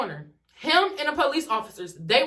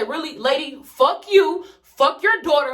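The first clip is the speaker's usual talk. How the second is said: angry outburst; 3 semitones higher, 5 dB louder, slower than usual. Retold or imitated. Cussing out the mother.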